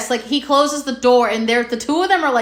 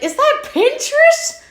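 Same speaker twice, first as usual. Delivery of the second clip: very high, drawn-out incredulous question; sudden puzzled realisation; 14 semitones higher, slower than usual. Retold or imitated. Re-enacting spotting the logo mid-movie, high incredulous surprise. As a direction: replay a baffled discovery, squeaking upward. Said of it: "it was how I said it".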